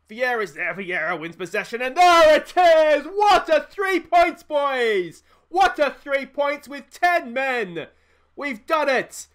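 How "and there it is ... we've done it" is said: much higher and louder, slow triumphant roar; ecstatic celebration. Real, not acted. Manager celebrating a late winner: ecstatic roar. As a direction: roar it like the winner just went in. Winning goal, last seconds.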